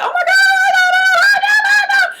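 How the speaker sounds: hammed-up panicked shriek, very high and loud; mock hysterical wail; freaking out. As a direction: imitate a mother screaming in panic. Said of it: "she freaking out".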